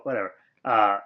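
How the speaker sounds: ordinary talk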